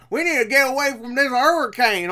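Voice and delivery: hammed-up father character. Reviewer mocking the father's hurricane line, hammed-up. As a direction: overplay a panicked movie father, imitating his accent. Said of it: "the father character is just like".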